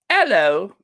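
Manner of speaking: high, loud British "'ello"; playful British greeting with dropped H; mimicked British accent. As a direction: imitate a cheeky British hello. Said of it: "somewhat mimicked Jamil's accent".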